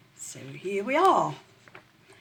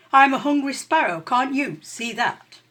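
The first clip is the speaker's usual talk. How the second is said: sparrow voice; 5 semitones higher, 8 dB louder than usual. A narrator's reading. Sparrow retorting to rat.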